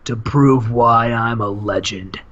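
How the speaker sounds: low, slow solemn promo; the Warrior. Acted. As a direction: intone it straight to camera, deliberately. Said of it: "into the camera and says".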